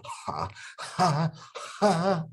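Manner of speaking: ha's pushed out with effort; pushed laughing. Shown as a demonstration. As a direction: force the ha's out. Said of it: "When I have to go".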